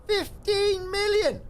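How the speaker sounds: very high, loud, drawn-out squeal; excited big-money cry. Real, not acted. Player announces a big in-game sale, excited high cry. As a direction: stretch it out in a high, loud cheer.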